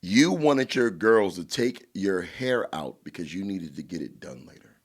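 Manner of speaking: normal talking voice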